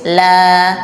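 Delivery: "la" said thin and bright with an open A; a light, unrounded "la". Shown as a demonstration. Teacher contrasting the thin "la" with the heavy one. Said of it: "Kalau dibaca tipis".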